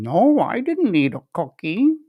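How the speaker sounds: put-on high, sing-song denial like a caught kid; guilty small child. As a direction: play a child fibbing with crumbs on his face. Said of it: "small child with cookie crumbs and chocolate smeared".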